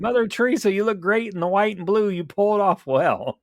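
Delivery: high, loud hammed-up flattering voice; mock compliment. Friend jokingly flattering zombie Mother Teresa, high hammy voice. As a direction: gush at her like a fawning fan.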